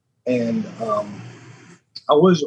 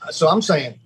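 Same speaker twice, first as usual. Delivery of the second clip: ordinary talk, nothing marked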